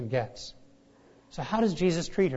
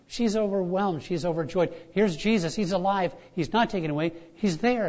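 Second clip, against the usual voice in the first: usual speaking voice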